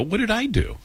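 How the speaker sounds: high, soft mock protest of innocence; mock indignant what. Retold protest at being excluded.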